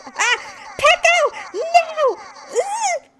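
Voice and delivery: very high, loud squeal of protest; startled shriek. Real, not acted. Streamer pranked by Pecco mid-story, loud high shrieking. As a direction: shriek the culprit's name in alarm.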